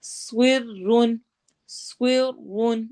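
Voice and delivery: slow سورن with heavy sad and ra; heavy letters. Shown as a demonstration. Teacher demonstrating heavy letters for students, slowly. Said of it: "سواد بھی heavy ہے".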